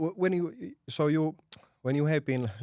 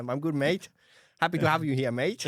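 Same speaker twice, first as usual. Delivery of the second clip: mock Australian accent; 5 semitones higher than usual. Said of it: "maybe we can hear a little Australian".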